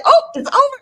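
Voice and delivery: high, loud, sudden alarm; startled realization. Retold or imitated. Host caught mid lip sync, in a high, loud startled yelp. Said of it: "I'm like".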